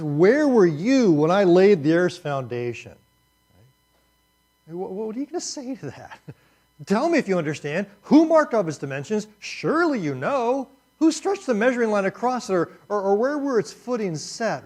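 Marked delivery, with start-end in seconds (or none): 0.0-3.0 loud, slow, commanding and high; God confronting Job from the storm; booming voice of God. Pretend. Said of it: "let me be God". 6.9-14.6 high, loud, mocking challenge; God taunting Job with questions; sarcastic God. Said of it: "feel the sarcasm just dripping".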